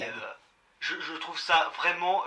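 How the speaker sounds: ordinary delivery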